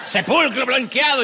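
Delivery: high, loud, laughing insult; laughing mock insult. Couple trading insults, laughing. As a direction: spit it out through a laugh.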